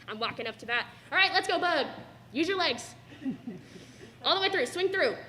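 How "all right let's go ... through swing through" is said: imitating dad's loud, higher batting cheer; dad coaching. Graduate imitating her dad cheering her at bat. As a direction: call it out like a dad at the plate. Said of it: "I love hearing".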